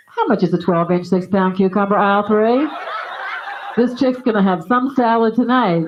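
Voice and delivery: loud checkout loudspeaker call; intercom announcement. Retold or imitated. Checkout clerk paging a price check over the speaker, loud.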